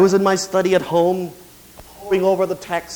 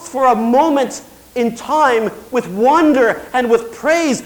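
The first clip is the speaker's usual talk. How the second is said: raised, emphatic pulpit delivery; impassioned preaching; 7 semitones higher, 6 dB louder than usual. Real, not acted. Preacher to congregation on praise without reason, impassioned. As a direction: preach it out with stretched key words.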